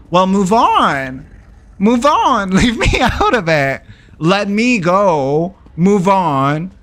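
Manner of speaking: high, drawn-out acted outburst; worked-up retelling of a fed-up demand; exasperated retort. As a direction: plead and demand to be let go, pitch jumping up.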